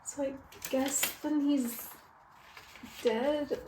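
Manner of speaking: hushed, halting mock grief; mock heartbreak. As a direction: reluctantly admit it, softly. Forced to admit the death.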